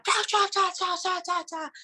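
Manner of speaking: mock Chinese yelling, high; hammed-up shouting gibberish; imitating a yelling man. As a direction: rattle off angry gibberish. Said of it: "a gritar".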